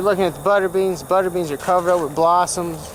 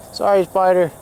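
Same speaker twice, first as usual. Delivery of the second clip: ordinary talk, nothing marked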